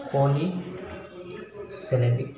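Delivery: polysyllabic drawn out very slowly; teacher modelling polysyllabic slowly; very slow syllable demo. Shown as a demonstration. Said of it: "हम polysyllabic words कह सकते हैं".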